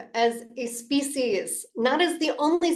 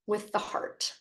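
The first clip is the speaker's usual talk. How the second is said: whisper; 8 dB quieter than usual. Acted. Reciting a quote, hushed.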